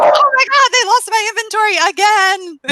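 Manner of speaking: loud, very high panicked wail; mock freak-out. Newbie calling her friend about lost inventory, mock freak-out. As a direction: wail it like a hysterical caller, hammed up. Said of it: "every day freaking out".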